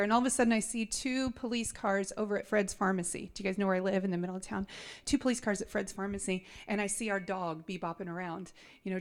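ordinary delivery

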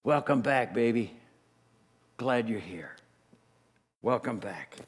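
soft and low, gently welcoming the lost son home; tender welcome. Acted. Father welcoming his runaway son home, soft and low. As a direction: hush it down like a father holding his boy.

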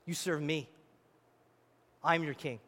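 low, soft, firm voice of God; God speaking quietly. Retold or imitated. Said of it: "God says".

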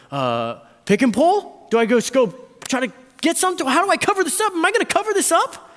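spiralling into a frantic cover-up plan; frantic panic. Retold or imitated. Teen scheming to hide the damage, frantic and high-pitched. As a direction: voice shooting up high and loud, rushing.

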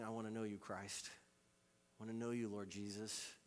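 soft, hushed words to Christ; quiet prayer. Acted. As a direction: pray it gently, almost to yourself.

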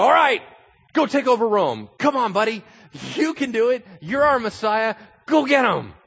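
high, loud pep-talk; cheering crowd. Acted. Crowd urging Jesus to take Rome: loud cheering. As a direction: pump up the buddy for battle.

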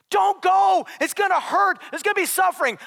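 very high, loud acted begging; shouted plea. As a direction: cry out to stop a friend leaving. Acted elders begging Paul to stay.

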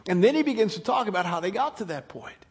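normal talking voice

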